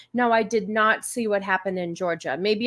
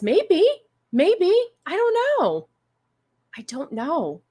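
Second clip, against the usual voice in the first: high-pitched, drawn-out playful not knowing; squeaky-high coy shrug of a voice; coy uncertainty; 8 semitones higher, slower than usual. Real, not acted. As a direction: pitch it way up, coyly noncommittal. Coy reply to rumor about bedmates.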